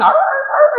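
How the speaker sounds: high yappy imitated barking; mock dog barks. Mocking the barking intruders as yapping dogs. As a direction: bark like a pack of dogs.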